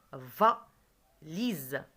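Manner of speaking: "Va, lise" split, with a loud va, a gap, then lise; "Va, lise" as two detached teaching beats; syllable by syllable. Shown as a demonstration. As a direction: punch "Va", pause, then say "lise". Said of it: "Deux syllabes".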